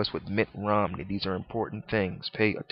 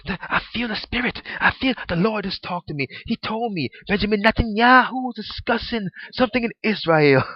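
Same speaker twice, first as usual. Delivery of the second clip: much higher, louder mock preacher; 11 semitones higher, 6 dB louder than usual. Mocking a preacher he saw, higher louder send-up. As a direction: send up a self-important preacher. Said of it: "like that preacher I saw ... talking about".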